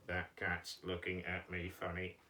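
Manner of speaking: low, monotone puppet; puppet Mike's voice. Pretend. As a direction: speak low and soft on one level note. Teacher voices puppet Mike.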